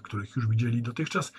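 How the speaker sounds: ordinary delivery, nothing marked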